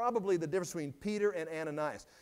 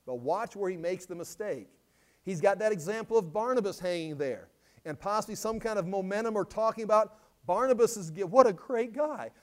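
usual speaking voice

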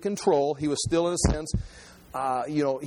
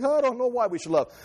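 higher mock voice of a clueless believer; mock clueless churchgoer; 6 semitones higher than usual. Preacher mocks believers who don't know why to love, clueless whine. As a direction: play the shrugging, puzzled churchgoer. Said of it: "It's like".

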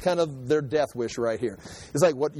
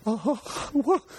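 startled; 6 semitones higher than usual. Retold or imitated. Startled people before an angel.

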